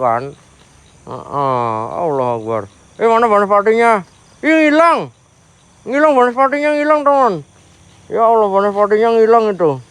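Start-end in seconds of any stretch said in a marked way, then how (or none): 3.0-9.8 much higher, louder alarmed cries; high, loud startled exclaiming; startled excitement. Real, not acted. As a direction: shout that it vanished. Spirit vanished, startled.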